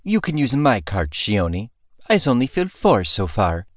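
lightly voiced stern cook; motherly mock scold. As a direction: scold with affection.

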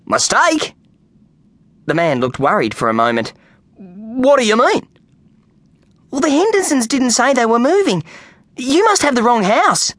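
high, unsure voices; puzzled man then earnest boy; worried exchange. A narrator's reading. Kenny then Brian voiced.

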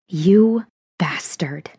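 slow whispered hiss; quiet venomous whisper; hissed quietly. A narrator's reading. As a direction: hiss it under your breath with contempt. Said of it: "I hissed quietly".

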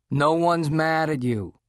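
slow, clipped-off snap; snapped. A narrator's reading. Dad to Nick, a slow mild snap. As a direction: bite the words off, slowly. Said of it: "his dad snapped".

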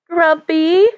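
put-on high, breathy young woman's voice; high breathy girl. A narrator's reading. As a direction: call out lightly in a high, breathy voice. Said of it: "A high, breathy voice".

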